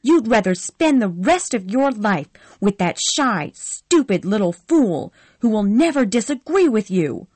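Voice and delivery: high, loud furious shouting. Acted. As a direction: scream your accusations at him. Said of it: "Scarlett said furiously".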